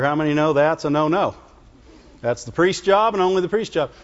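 normal talking voice

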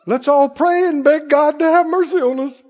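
high, hammed-up pleading; mock fearful agreement. Congregation answering fear preachers, mock fearful pleading. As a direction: imitate a frightened flock begging for mercy. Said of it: "They're wanting us to all go".

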